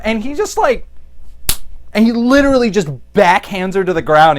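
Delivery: usual speaking voice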